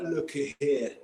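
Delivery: put-on a British rock-star English accent as Saddam; lightly done English accent, a punk Saddam defending himself; a mild British accent with a punch on do; Saddam Hussein English accent. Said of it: "Saddam Hussein English accent".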